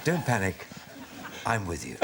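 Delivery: mock soothing pilot, dropping low at the end; lightly played calm captain, pausing between phrases; reassuring captain. Pilot comforting passengers after engine failure, mock-reassuring. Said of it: "the most reassuring voice a plane passenger".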